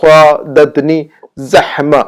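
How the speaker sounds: صادتنی زحمہ slowly, with heavy صا and clear ح; careful pronunciation. Shown as a demonstration. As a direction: model صادتنی زحمہ deliberately, word by word. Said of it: "بالکل درست کرنا ہے آپ نے".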